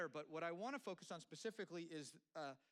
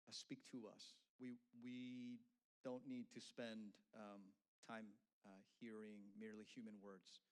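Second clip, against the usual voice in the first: soft, earnest opening prayer, lower and hushed; quiet prayer; 5 semitones lower, 6 dB quieter than usual. Real, not acted. Pastor praying over the congregation, hushed and earnest. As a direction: pray softly before the sermon.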